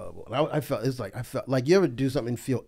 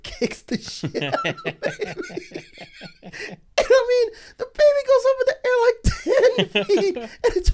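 words squeezed out high through laughter; cracking up while telling it; laughing through it; slower than usual. Real, not acted. Comedian laughing as he tells his friend the story.

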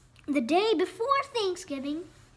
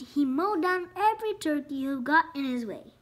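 plain reading voice

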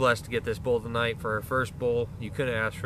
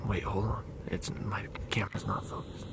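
whispering; 3 semitones lower, 9 dB quieter than usual. Real, not acted. Quiet hunt near the animals.

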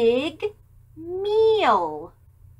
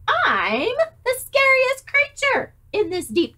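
boasting mouse; 10 semitones higher, 6 dB louder than usual. Acted.

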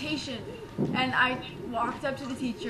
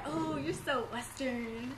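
slow, softer imitation of others; mock drawn-out judgement; 6 dB quieter, much slower than usual. Imitating people down south, slow and mocking. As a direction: drag it out, mildly mocking. Retold Southerners judging the speaker.

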